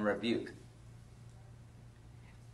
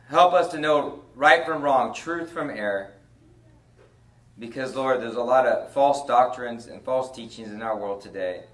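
prayer; 5 semitones lower than usual. Acted. Prayer in the sermon.

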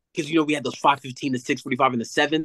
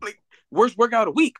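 incredulous repeat; 9 semitones higher than usual. Retold or imitated. Player repeats coach's verdict incredulously.

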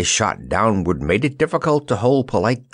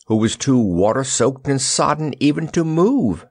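plain reading voice

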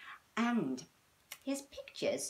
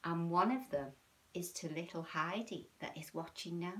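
ordinary delivery

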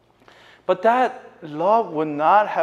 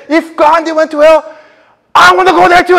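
loud, high defiant outburst; angry retort; 16 dB louder, faster than usual. Retold or imitated. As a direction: spit it out in defiance. Girl storms off angry.

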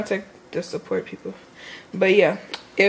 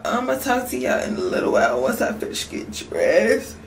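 ordinary talk

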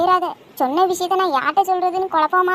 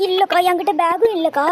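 ordinary delivery

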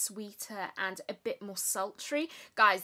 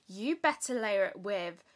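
ordinary delivery

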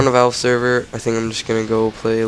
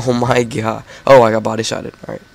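usual speaking voice

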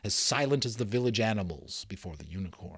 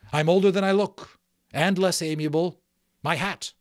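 loud, higher-pitched threat; angry demand; 6 semitones higher, 7 dB louder than usual. A narrator's reading. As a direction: raise your voice to intimidate the outlaw. Magician angrily demands his hat back.

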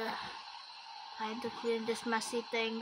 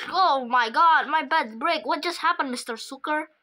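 loud, higher, alarmed outburst; shocked shout; 3 semitones higher, 7 dB louder than usual. Pretend. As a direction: yell out as the bed collapses. Bed breaks under him.

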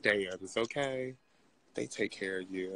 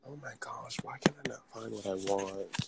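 whispered muttering. Real, not acted. Shopper muttering to self over not finding glitter, whispered. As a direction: grumble under your breath, whispering.

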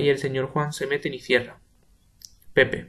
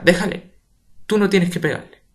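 higher, louder pleading shout; urgent shout; 5 semitones higher, 6 dB louder than usual. Acted. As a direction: shout to stop the fight. Rosa intervening in fight.

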